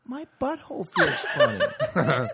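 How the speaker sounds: very high, slow, laughing whine; whiny kid voice. Pretend. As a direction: play a tattling child to the principal. Whining kid voice.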